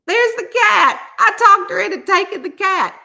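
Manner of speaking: high, loud, delighted announcement; triumphant. Real, not acted. Telling viewers she talked her into it, triumphant. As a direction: crow over the win. Triumphantly shows the adopted cat.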